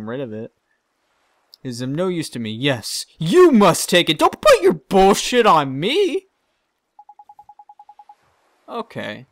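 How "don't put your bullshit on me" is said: louder, slow protest; indignant. Real, not acted. Player refuses the peddler's pot, indignant. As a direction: push the nonsense back at him.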